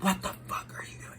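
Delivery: a hushed, breathy hiss of a quote; mock whisper. Girl who shoved her, hissed under her breath. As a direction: hiss it like an angry stranger up close.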